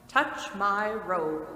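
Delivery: slow, loud and commanding; giant spirit's command. Acted. The spirit tells Scrooge to hold his robe, slow and commanding. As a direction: lay it down slowly like an order from a giant.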